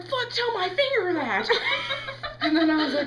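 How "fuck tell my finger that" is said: high, loud mock yell; hammed-up agonized indignation; pained retort. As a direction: shout back in exasperation.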